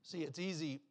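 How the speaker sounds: ordinary talk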